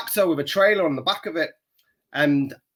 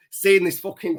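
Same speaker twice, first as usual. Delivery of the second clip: ordinary talk, nothing marked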